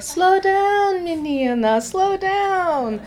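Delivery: high, drawn-out teasing call; teasing locals. Retold or imitated. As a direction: villagers teasing her to slow down. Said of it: "They're like".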